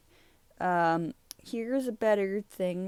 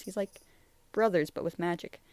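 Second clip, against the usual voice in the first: ordinary talk, nothing marked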